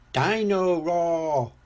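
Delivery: put-on gleeful toddler. A narrator's reading. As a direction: chant it like a small child at play. Small George with his toy.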